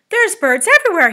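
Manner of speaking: high, very loud alarm; crying out. A narrator's reading. Captain at his telescope reporting to officers, crying out. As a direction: cry out the alarming sighting. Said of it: "he cried".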